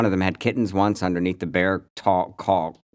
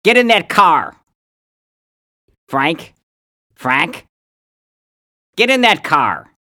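high, loud angry yell in upstate accent; pissed-off upstate accent; 9 semitones higher, 8 dB louder, slower than usual. Shown as a demonstration. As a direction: demonstrate yelling with hard upstate vowels. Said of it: "I start to talk like that".

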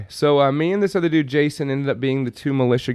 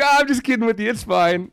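imitating De Niro; 10 semitones higher than usual. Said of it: "And he's like".

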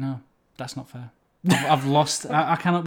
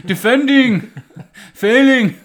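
hammed-up Gandalf shouts; 4 semitones higher, 10 dB louder, slower than usual. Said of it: "And then Gandalf's like".

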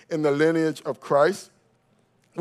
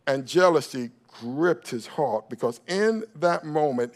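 ordinary talk, nothing marked

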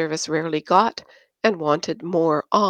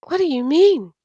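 much higher, naive surprise; wide-eyed question; 10 semitones higher, 4 dB louder than usual. Acted. Christine asks Josh naively, wide-eyed surprise. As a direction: ask it wide-eyed and innocent. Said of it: "wide-eyed as usual".

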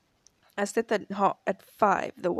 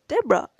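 "Deborah" said higher and louder; emphatic name; 6 semitones higher, 5 dB louder, faster than usual. Retold or imitated. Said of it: "she goes".

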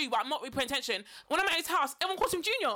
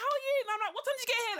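mock bright greeting; 10 semitones higher, much faster than usual. Faking familiarity with stranger.

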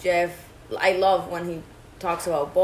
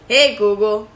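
calling out; 2 semitones higher, 9 dB louder than usual. Real, not acted. Calling the voice assistant.

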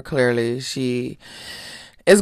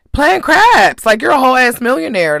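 exasperated outburst; 9 semitones higher, 10 dB louder than usual. Real, not acted. Ranting about [name]'s late-night craps.

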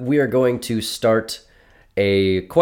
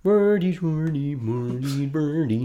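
Swedish Chef; 5 semitones higher, 5 dB quieter, slower than usual. Pretend. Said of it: "you were doing Swedish Chef".

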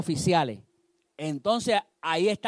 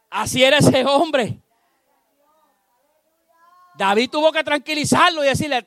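ordinary talk, nothing marked

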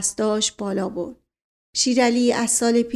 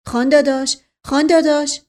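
louder, higher alarmed call; worried calling out; 5 semitones higher, 6 dB louder than usual. A narrator's reading. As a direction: call out to him anxiously, rising in urgency. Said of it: "با نگرانی به طرف او دوید". (با نگرانی و صدای بلند)

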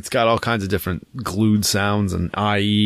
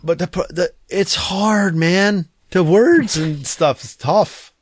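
high, drawn-out mock complaint; playful whine, stretching the words; mock whining; 7 semitones higher, slower than usual. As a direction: plead like a struggling kid. Mock whining defence of bad reading.

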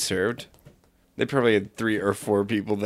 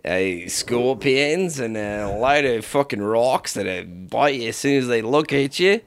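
put-on Australian, listing hazards; Australian accent. Local warns about deadly wildlife, mock Australian accent. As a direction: list dangers in mock Australian. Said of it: "Australian different things".

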